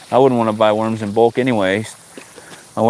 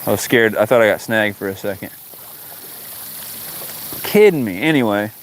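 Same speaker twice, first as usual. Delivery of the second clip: usual speaking voice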